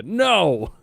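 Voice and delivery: high, loud flat refusal; emphatic no. Real, not acted. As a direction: shout the no down.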